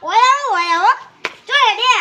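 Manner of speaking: loud summoning call. Acted. Telling someone to come over here, calling out.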